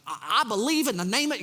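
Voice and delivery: hammed-up Southern church accent, showy faith; mock Southern accent. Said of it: "it's always a Southern accent thing".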